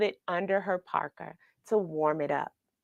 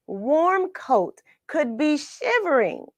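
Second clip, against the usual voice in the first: plain reading voice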